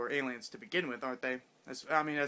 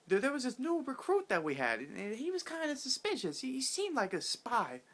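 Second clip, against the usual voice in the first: much higher, strained mock villain voice; mock Starjin rant; 10 semitones higher than usual. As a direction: pitch it way up like an outraged boss exposing a spy. Starjin voiced in retold scene.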